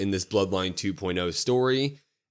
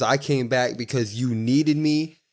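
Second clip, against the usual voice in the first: slow deliberate plea, mildly imitated; slow, heavy promo; 3 semitones higher, much slower than usual. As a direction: lean on each word, asking for help. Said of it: "He goes".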